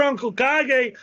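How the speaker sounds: normal talking voice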